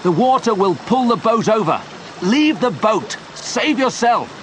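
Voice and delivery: high-pitched, urgent pleading orders; urgent calling. A narrator's reading. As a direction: call out insistently over danger. Urging friend to abandon boat.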